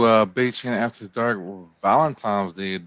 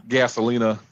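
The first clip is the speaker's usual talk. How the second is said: usual speaking voice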